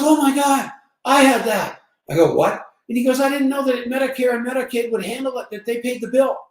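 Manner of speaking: friend's excited story, pitched well up; excited high voice. Retold or imitated. Old friend tells the narrator, excitedly and high. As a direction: gush it out, high and loud at first.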